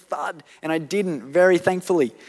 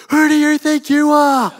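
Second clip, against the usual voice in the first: much higher and louder, a hammed-up indignant snap; indignant retort; 9 semitones higher, 12 dB louder than usual. Tempted to snap back at the angry driver, loud indignant retort. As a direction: fire it back like you won't be pushed around. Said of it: "I also had a temptation to say".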